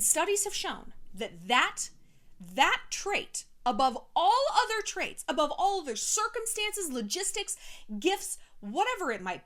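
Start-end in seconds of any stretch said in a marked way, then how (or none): 2.5-6.9 higher and louder, stressing all; emphatic. Real, not acted. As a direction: drive home each above all. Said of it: "emphatic point".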